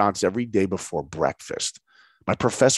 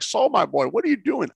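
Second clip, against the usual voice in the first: put-on higher-pitched startled old mentor; Bill Matthews; 10 semitones higher than usual. Old man in a tug of war with student, puzzled. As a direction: lightly imitate an old man caught off guard. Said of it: "seventy-year-old man. He's like".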